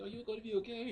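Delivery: put-on high child-like falsetto question; worried little girl. Little girl asks dying soldier.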